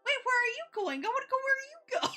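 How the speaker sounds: high, fast pleading whine; imitating Misa. Misa begging Phil not to leave, high and fast. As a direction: chase after him in a high, needy voice. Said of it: "Misa just looks at him and goes".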